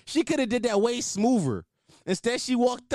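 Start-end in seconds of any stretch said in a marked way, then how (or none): none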